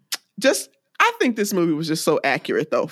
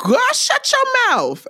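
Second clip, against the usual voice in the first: much higher, slow, drawn-out mom scolding; imitating a mom on the phone; 3 dB louder, slower than usual. Lil Worm's mama on the phone, high slow mock scolding. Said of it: "And she says".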